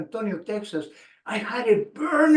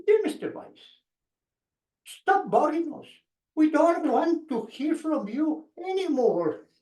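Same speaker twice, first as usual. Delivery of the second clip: much higher, teasing mock-official read; hammed-up fake rejection letter, pitched way up; Tina's joking letter; 7 semitones higher than usual. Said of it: "Tina was joking".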